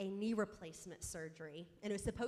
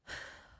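imitated stressed sigh; demonstrating a pent-up exhale; big audible breath; 6 dB quieter than usual. Shown as a demonstration. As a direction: let out a heavy breath. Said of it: "he kept hearing me go".

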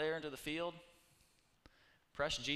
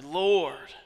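cry out; 2 semitones higher, 13 dB louder than usual. Acted. Lepers crying out to Jesus.

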